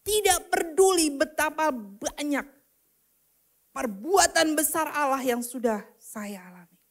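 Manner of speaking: ordinary talk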